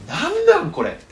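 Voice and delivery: high, loud cry of disbelief; bewildered outcry. Retold or imitated. Disciples reacting to Jesus' death, high outraged cry. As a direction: throw up your hands in confusion.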